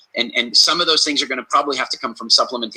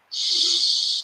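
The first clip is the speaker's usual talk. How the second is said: a sudden high gasp of fright; sharp startled gasp; 4 dB quieter than usual. Shown as a demonstration. Demonstrating the gasp you do when you see a lion. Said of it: "you don't do this".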